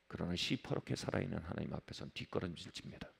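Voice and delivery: very low, hushed preaching; soft and low, as if backing away. Real, not acted. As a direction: drop right down to a grave undertone. Sudden drop describing retreat from God.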